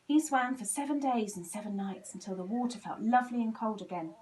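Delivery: ordinary delivery, nothing marked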